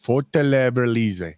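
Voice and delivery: Fortaleza Libre slow, the middle blurred into a b sound; wrong pronunciation. Shown as a demonstration. Cohost getting the name wrong again, slow. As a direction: mispronounce Fortaleza Libre slowly. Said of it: "No, Fortaleza".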